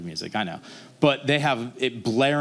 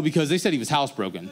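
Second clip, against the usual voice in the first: ordinary talk, nothing marked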